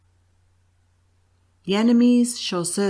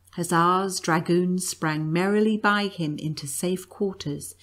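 plain reading voice

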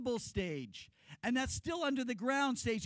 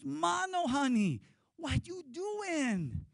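high, drawn-out sweet wife calling; 6 semitones higher, much slower than usual. Retold or imitated. A wife calls sweetly to her husband on the grass. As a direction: coo at her husband, stretching the question slowly. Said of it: "And she goes".

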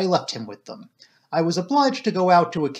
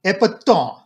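louder, higher French exclamation; excited cry; 4 semitones higher, 7 dB louder than usual. A narrator's reading. Poirot hears of the manuscript, cries out excitedly. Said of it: "cried Poirot".

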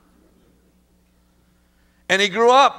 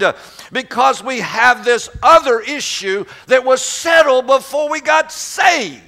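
rising, louder preacher cadence; preaching; 7 semitones higher, 3 dB louder than usual. Acted. Preacher driving home a point to the congregation, building. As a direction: press the point like a pulpit preacher.

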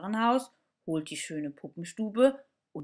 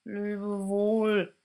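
strained, squeezed farewell; mildly pressed goodbye; 3 semitones higher, 4 dB louder than usual. Acted. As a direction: push the words out through a tight throat. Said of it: "mit sehr gepresster Stimme".

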